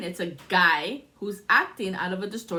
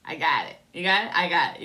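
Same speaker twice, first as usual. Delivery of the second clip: ordinary talk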